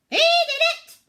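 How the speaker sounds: very high, very loud squawk; squawking parrot. A narrator's reading. Silly parrot blaming Pete, shrill squawk. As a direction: screech it like a tattling bird. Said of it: "squawked the silly bird".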